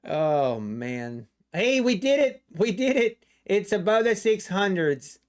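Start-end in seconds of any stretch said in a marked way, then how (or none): 1.5-5.2 high, excited cheer. Real, not acted. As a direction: celebrate the breakout. Market finally breaks six hundred.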